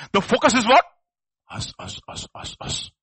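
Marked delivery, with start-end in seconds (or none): 1.5-2.9 mocking whisper. Mocking the selfish focus.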